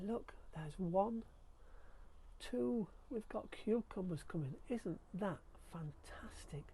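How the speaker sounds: slow, hushed, thrilled whisper; excited whisper. Real, not acted. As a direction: hush as if not to scare them. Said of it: "I'm so excited".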